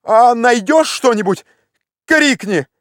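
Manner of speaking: high, pressing commands; wildly excited shout. A narrator's reading. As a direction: drive the climber on, beside yourself. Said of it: "закричал Легран вне себя от волнения".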